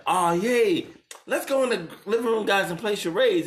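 high, bouncy fake excitement; sing-song cheerful invite; hammed-up peppy voice; mock eager friend. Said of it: "He was trying to, like".

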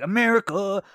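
high, hammed-up American patriot; hardcore patriot. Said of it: "like, a hardcore patriot".

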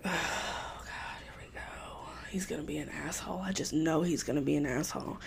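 hushed eye-roll whisper to herself; whispered inner thought. Retold or imitated. Woman at the mall whispering her annoyance to herself. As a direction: mutter it under her breath.

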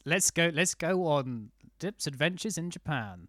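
slow, grand presenter build-up; mock announcer intro. Host teasing his friend into telling his Japan story, slow mock announcer. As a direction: announce it like a travel show host.

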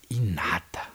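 hushed mock-dramatic drop; suspenseful whisper. Storyteller finding nobody at the buildings, suspenseful stage whisper.

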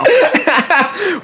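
high rising burst of laughter. Real, not acted. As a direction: let a laugh break out high. Laughs at the joke.

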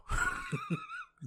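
short, high-pitched giggle; real squealing little laugh. Real, not acted. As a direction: burst into a quick high laugh. Laughs at own joke to wife.

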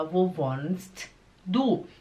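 WOHNST held with its T sounded, DU set apart; slow, careful speech. Shown as a demonstration. Teacher demonstrating slow speech, each word separated. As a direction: say "wohnst" slowly with a full T before "du". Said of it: "devagar, você fala".

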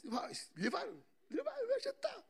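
very high, laughing mock whine; mocking woman. Preacher mimics the stubborn woman answering the angels, high laughing mock whine.

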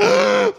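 sharp high mock gasp; startled gasp. Imitating a startled man gasping. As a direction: gasp like you were caught off guard. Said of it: "the guy was like".

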